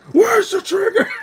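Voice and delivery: high, loud hammed-up Where's the trigger; imitating Batman shouting; mock Batman. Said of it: "I was just laughing my ass off".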